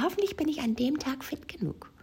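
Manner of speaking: high-pitched anxious inner voice; voiced worry. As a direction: fret in a put-on high voice. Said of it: "kommt keine Lust, sondern eher ein".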